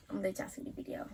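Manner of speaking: whispered aside. Real, not acted. Teasing her viewers about the video, whispered. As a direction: drop to a secret whisper. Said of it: "repeats it whispered".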